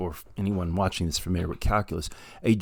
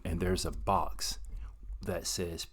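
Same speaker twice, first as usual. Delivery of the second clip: whisper; 7 dB quieter, slower than usual. Real, not acted. Building suspense about the box.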